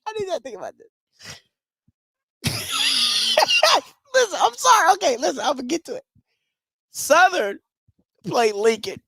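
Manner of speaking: high squealing laugh-talk; wheezing with laughter, can barely speak; helpless laughter. Real, not acted. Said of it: "I'm sorry. I don't know why this is so funny".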